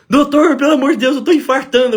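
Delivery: high, loud cry for the doctor; imitated panicked plea. Patient phones his doctor from the highway, acted panic. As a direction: plead urgently as a panicking patient.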